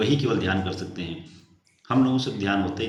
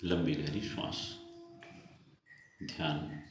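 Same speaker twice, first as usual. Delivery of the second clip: guided meditation; 2 semitones lower, 6 dB quieter than usual. Real, not acted. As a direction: settle them gently into stillness, voice sinking ever softer. Leads a seated meditation.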